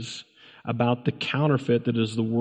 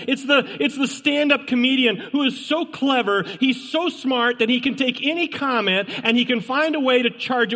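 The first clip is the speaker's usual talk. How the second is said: high, emphatic preacher's delivery; full-force preaching; 12 semitones higher than usual. Real, not acted. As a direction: hammer each warning home, pitched high.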